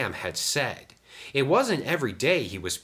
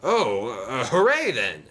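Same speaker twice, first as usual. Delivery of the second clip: hesitant start, then high, louder cheer; exclaiming; 5 semitones higher, 5 dB louder than usual. A narrator's reading. Sam learns he has passed, exclaiming happily. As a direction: burst into a hooray after a little uncertainty. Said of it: "exclaimed Sam".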